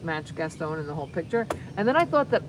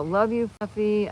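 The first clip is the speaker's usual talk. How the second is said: ordinary talk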